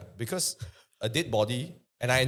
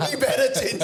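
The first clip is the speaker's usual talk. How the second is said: high laughing tease; 13 semitones higher, 5 dB louder than usual. Real, not acted. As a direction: laugh while teasing. Laughing at friend's answer.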